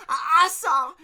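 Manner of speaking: put-on very high, loud boy's cry; shrill and urgent, arms waving. Torin crying out to the workers, shrill. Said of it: "his shrill, high voice".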